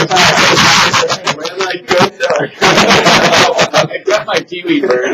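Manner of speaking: slow, laughing through the words. Real, not acted. Teacher to student about the kiwi bird, laughing. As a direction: giggle it out slowly.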